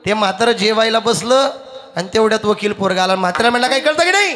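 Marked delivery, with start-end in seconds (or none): 3.7-4.3 higher, sharp scolding; scolding son. Retold or imitated. Lawyer son scolds his old father.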